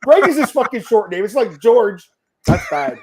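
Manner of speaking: high, loud incredulous rant over a name mix-up; half-laughing, loud and high, can't believe it; laughing outrage. Real, not acted. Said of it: "Sorry, I thought that was hilarious".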